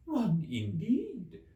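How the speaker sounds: high, very slow huffy squeak; indignant Dormouse. A narrator's reading. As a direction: scoff with offended pride. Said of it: "said the Dormouse indignantly".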